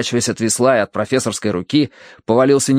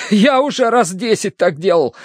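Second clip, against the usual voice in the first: high, impatient brush-off; shivering dismissal; 9 semitones higher than usual. A narrator's reading. Terence dismisses the warning while boarding, impatient. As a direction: brush off the warning, still chilled. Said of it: "отмахнулся он".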